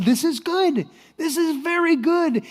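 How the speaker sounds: high-pitched cheerful insistence; relentlessly positive. Acted. Servant just out of prison, relentlessly positive as ever. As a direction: declare it with unshakable optimism. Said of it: "true to form".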